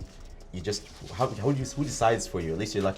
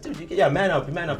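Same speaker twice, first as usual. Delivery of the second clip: higher, louder mock man up; half-hearted bark of man up; mock tough talk; 9 semitones higher, 8 dB louder than usual. Dad pushing his son to toughen up, mock loud.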